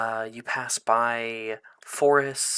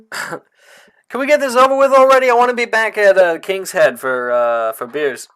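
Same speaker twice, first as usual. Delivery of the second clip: impatient NPC voice; 8 semitones higher, 12 dB louder than usual. Acted.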